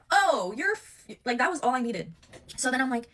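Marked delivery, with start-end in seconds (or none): 0.1-1.1 loud, high, drawn-out "oh"; outraged realization. Retold or imitated. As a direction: burst out in indignant discovery. Exclaims on discovering the messages.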